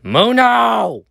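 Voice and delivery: calling out. Acted. Calling a teammate to help.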